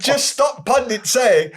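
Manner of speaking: high, drawn-out slow plea; exasperated plea. Real, not acted. Host pleads for pundits to stop, exasperated plea. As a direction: beg slowly at a high pitch.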